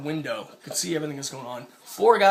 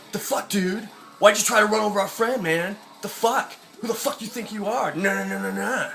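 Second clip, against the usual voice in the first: higher, jeering take on angry bros, trailing into nagging nonsense; mocking angry heckle; 5 semitones higher than usual. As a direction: imitate an indignant mob, voice pitched up. Snowboarder group hassling him, acted out.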